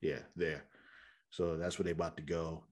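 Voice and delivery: usual speaking voice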